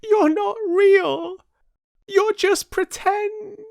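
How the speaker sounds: very high, loud cry at them; upset confronting shout. Acted. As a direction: accuse them through tears. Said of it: "turning to confront them".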